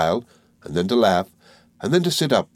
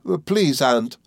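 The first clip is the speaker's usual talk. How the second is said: high, pleading call; understated tearful entreaty; sobbing; 7 semitones higher, slower than usual. A narrator's reading. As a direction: appeal to her through mild sobs. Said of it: "sobbed Emily".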